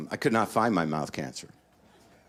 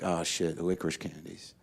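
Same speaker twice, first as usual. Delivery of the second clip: quiet realization; 3 semitones lower, 6 dB quieter than usual. Retold or imitated. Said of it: "And I'm like".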